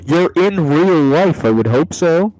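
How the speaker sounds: exasperated loud rant. Real, not acted. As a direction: yell it out in frustration. Rant about game wording.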